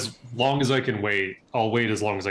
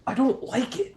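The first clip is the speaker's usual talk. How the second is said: grossed out; 13 semitones higher than usual. Real, not acted. Disgusted by mouse voice.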